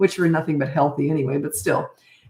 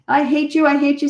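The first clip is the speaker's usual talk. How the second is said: high, loud bratty yell at a parent; child's tantrum; 8 semitones higher, 4 dB louder than usual. Retold or imitated. Kid screaming at parent over a rule, high loud yell. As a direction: throw it at Mom like a furious kid.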